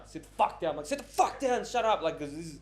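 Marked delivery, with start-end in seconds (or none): 0.1-0.7 retold firm command, slightly raised; firm order. First retold order to troublemaker. 0.9-2.0 higher, exasperated retold command; escalated angry order.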